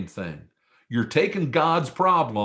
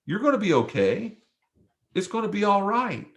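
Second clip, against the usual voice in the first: reassuring friend; 8 semitones higher than usual. Retold or imitated. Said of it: "tap you and say".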